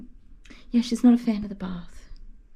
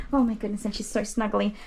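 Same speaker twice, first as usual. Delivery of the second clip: ordinary talk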